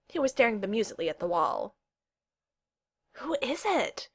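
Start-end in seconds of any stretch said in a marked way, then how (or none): 3.1-4.1 high-pitched curious question. A narrator's reading. Owen asks about Kel's secret lover, curious. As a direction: ask it lightly, pitched up with interest. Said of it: "Owen asked curiously".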